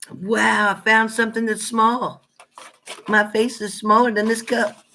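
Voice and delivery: high-pitched, delighted marvelling; playful amazement. Real, not acted. Marvels at a giant cup.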